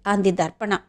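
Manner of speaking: ordinary delivery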